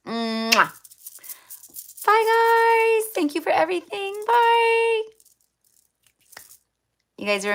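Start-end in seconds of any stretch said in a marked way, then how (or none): 0.1-0.7 a loud smacking air kiss; blown kiss. Real, not acted. Signing off to viewers with a loud blown kiss. 2.0-5.0 very high, loud sing-song bye; squeaky goodbye. Real, not acted. Waving off her viewers in a very high, loud chirpy voice.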